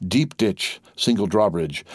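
ordinary delivery, nothing marked

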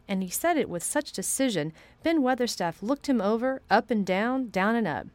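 usual speaking voice

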